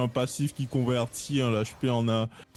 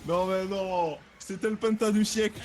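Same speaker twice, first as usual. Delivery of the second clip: excited; 9 semitones higher than usual. Real, not acted. Reacting to the pentakill.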